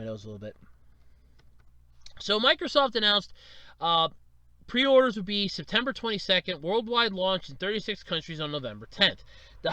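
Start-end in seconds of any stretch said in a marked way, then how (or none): none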